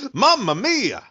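high, loud hammed-up Italian exclamation; mock Italian accent. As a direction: cry it out like a cartoon Italian.

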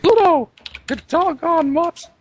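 very high, loud falsetto cartoon scolding; angry cartoon mouse yelling at a dog; Mickey Mouse. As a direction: yell it in a squeaky mouse falsetto, drawn out. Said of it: "Mickey's constantly yelling at him".

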